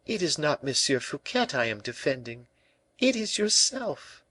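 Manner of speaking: put-on high-pitched earnest young woman; earnest La Valliere. A narrator's reading. La Valliere tells the king whom she defends, earnestly.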